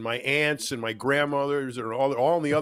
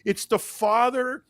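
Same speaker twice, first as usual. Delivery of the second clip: ordinary talk, nothing marked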